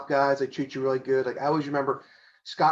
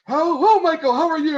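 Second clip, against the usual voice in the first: much higher, louder announcer greeting; Howard Finkel voice; 13 semitones higher, 7 dB louder than usual. Retold or imitated. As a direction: boom out the hello like a ring announcer. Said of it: "that voice".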